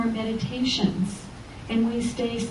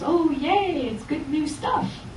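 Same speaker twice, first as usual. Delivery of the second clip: higher, chirpy cheer from the crowd; mock delight; 6 semitones higher, 5 dB quieter, slower than usual. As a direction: gush like cheerful townsfolk. Everybody cheering new buildings.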